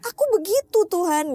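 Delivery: loud, high mock wail falling away; crying complaint. Speaker imitates her own tearful prayer, wailing. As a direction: wail it to God, re-enacted. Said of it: "ngomong sama Tuhan tuh kayak gitu".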